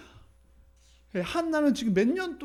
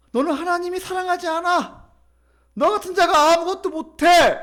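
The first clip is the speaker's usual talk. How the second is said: scornful rival, rising to a high, loud jab; needling taunt; 8 semitones higher, 5 dB louder than usual. Acted. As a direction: tear her down with mounting contempt. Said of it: "한나의 속을 긁는 거예요".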